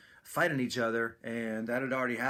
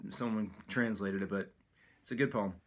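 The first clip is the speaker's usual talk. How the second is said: ordinary talk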